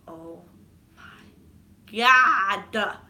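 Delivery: hush, pause, burst out loud; re-enacted horror swelling to a yell; building shock. Re-enacts dread as barrier opens.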